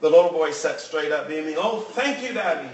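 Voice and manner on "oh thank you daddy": mild storytelling yell of delight; joyful yell. A narrator's reading. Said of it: "he yelled".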